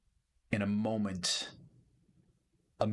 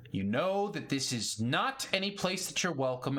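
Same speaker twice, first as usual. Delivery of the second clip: raised-pitch barkeep laying down the law; stern bartender warning; 8 semitones higher than usual. Acted. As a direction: stand on the counter and warn them off, voice pitched high. Bartender on the countertop warning intruders.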